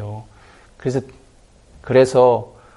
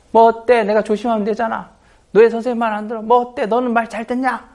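high, indignant retort; imitated squabble, pitched well up; acted quarrel; 8 semitones higher, 3 dB louder than usual. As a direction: bicker back like a defiant pupil. Acted quarrel between pupils.

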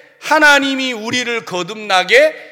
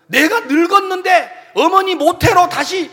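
mock Nicodemus; 8 semitones higher, 4 dB louder than usual. Nicodemus voiced objecting.

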